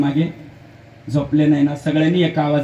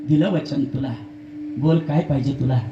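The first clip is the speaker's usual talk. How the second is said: giving in softly; 7 dB quieter than usual. Acted. Husband gives in to wife.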